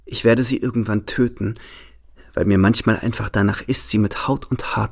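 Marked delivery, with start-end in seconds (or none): none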